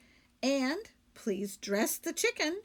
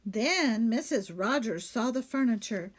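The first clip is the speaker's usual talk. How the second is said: usual speaking voice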